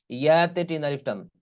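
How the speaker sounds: ordinary talk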